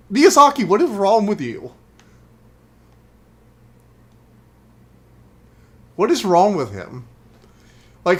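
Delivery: loud, high, incredulous rant; exasperated outburst. Real, not acted. Player ranting at Miyazaki over a fire trap, exasperated outburst. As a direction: cry out in baffled protest.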